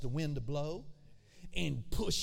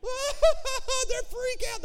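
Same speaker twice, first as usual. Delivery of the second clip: loud, very high mock wail; hammed-up hysterical crying; mock crying freak-out; 7 dB louder, much slower than usual. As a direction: wail in exaggerated panic. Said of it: "They started crying".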